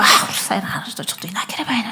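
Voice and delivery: soft, quick whispered grumble; annoyed grumble. Retold or imitated. Crowd grumbling about the noisy blind man, hushed. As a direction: mutter it under your breath, annoyed.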